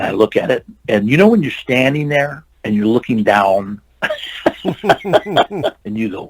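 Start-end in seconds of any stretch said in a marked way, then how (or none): 4.0-4.6 airy little laugh, no voice; breathy laugh. Real, not acted. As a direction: laugh softly at the memory of the drop. Laughs remembering the high fall.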